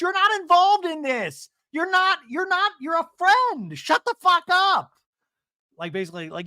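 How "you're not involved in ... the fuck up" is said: high, loud, slow tirade; hammed-up screaming quarrel voice; imitated angry shouting. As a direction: shout them down in mock fury. Acts out Emily shouting at Alexis.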